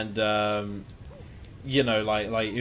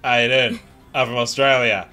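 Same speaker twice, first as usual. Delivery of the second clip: loud, broad mock Aussie accent; 3 semitones higher, 10 dB louder than usual. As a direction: ham up a cartoon Australian accent. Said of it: "most of Aussies are like".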